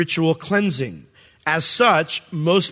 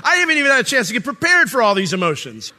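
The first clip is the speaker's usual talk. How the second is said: much higher, louder alarmed protest, laughing by the end; startled outcry; 8 semitones higher, 5 dB louder than usual. Retold or imitated. Overwhelmed dad reacts to his crying daughter, startled. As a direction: throw up your hands at the outburst.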